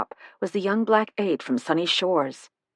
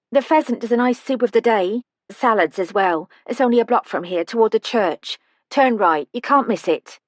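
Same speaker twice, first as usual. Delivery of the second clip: receptionist; 5 semitones higher, 5 dB louder than usual. Acted. Care home receptionist gives directions.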